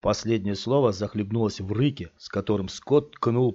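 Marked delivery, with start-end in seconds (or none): none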